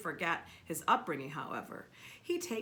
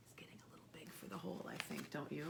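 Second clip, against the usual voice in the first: whisper; 6 semitones lower, 10 dB quieter, faster than usual. Real, not acted. Reader aside to child, whispering.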